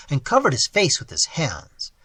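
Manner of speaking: usual speaking voice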